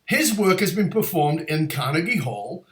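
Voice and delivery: usual speaking voice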